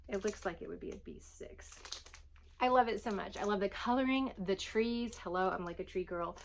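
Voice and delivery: normal talking voice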